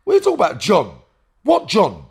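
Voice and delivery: high, loud and baffled; baffled outburst. Retold or imitated. Retelling the shock when accused of robbing John, high and loud. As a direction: shout it out in total confusion. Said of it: "I was like".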